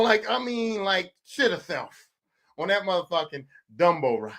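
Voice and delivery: ordinary talk, nothing marked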